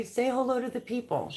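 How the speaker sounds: high, sing-song coo. Real, not acted. Cooing to chick on camera.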